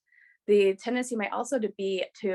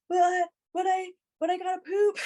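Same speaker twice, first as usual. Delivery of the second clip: flustered man; 10 semitones higher than usual. Retold or imitated. The poor guy pleading to stay, high laughing stammer. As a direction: play the startled man pleading. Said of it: "this poor guy's like".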